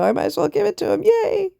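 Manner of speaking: put-on high, fast, sarcastic girly voice; mock the woman who gives in, high and quick; sarcastic mock cheer. Mocking a woman giving in.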